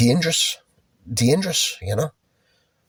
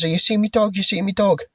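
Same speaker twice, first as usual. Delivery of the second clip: much higher, panicky woman asking about her lost dog; frantic woman; 10 semitones higher than usual. Retold or imitated. Dog owner pleads with strangers at the falls, high and panicked. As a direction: play the frightened dog owner begging for help. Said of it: "She says".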